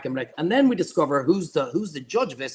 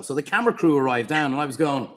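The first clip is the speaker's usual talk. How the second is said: ordinary talk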